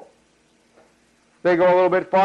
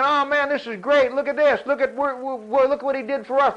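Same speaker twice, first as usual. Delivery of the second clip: higher-pitched, amazed and thrilled; excited crowd; 7 semitones higher than usual. Acted. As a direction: marvel like an overjoyed crowd. Voices the delighted Israelites after crossing.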